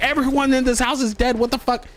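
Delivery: high-pitched frantic outburst, acted out; retold panic. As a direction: burst in crying out in alarm. Bursting in, retelling his panic.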